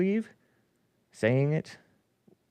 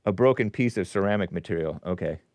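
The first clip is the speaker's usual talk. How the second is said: ordinary delivery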